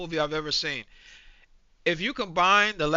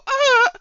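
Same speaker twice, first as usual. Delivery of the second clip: short yelp, much higher and louder, imitating a feeble cry; mock yelp; 10 dB louder than usual. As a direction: squeal it like a weak shove. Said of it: "and you push him and go".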